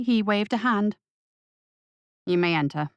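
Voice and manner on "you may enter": a cold, low butler voice; icy, dismissive permission. Acted. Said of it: "the butler said coldly".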